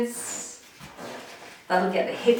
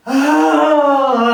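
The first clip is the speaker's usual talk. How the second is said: loud, raised "oh" of frustration; frustrated groan; 5 semitones higher, 9 dB louder than usual. Shown as a demonstration. Teacher showing frustration at a tricky catch, loud drawn-out groan. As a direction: let out a big exasperated groan. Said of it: "I get frustrated".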